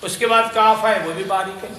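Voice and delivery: normal talking voice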